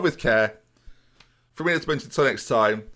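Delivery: ordinary talk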